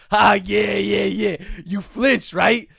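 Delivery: Knife Guy yelling at the comic who flinched; mocking shout. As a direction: laugh in his face and yell the insult. Knife Guy mocking after fake swing.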